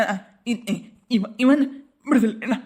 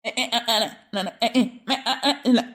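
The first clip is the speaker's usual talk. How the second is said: comic stammer; 3 semitones lower than usual. Acted. Flustered husband stammers a plea against the police complaint. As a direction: lower voice, stuck on first sounds before the words come.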